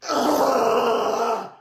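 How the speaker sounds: long, high, loud groan; exaggerated groan. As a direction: groan loudly as if overwhelmed by the taste. Acted overwhelmed reaction to food.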